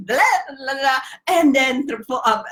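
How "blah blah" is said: ordinary delivery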